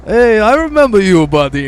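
high, loud cheery greeting; friendly store owner. Retold or imitated. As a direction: greet him like an old pal.

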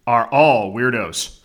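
drawn-out podcast sign-on; slow catchphrase. Acted. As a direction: stretch the catchphrase slowly. Show intro catchphrase.

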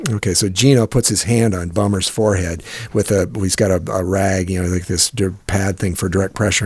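ordinary delivery

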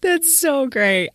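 high, slow and laughing; laughing cheer for a friend; laughing delight. Real, not acted. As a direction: gush with a laugh.

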